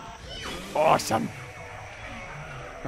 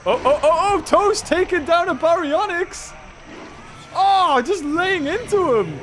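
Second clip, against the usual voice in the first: excited cheering; 7 semitones higher than usual. Real, not acted. Toast attacks Baryonyx.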